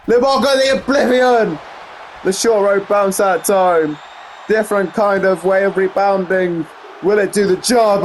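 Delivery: high burst, then sustained suspense; excited play-by-play. Real, not acted. Commentator calling Liv's finisher, excited play-by-play.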